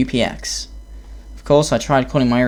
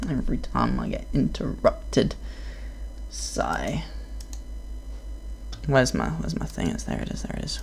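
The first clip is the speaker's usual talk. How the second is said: muttering to self; 7 dB quieter than usual. Real, not acted. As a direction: mumble it under your breath.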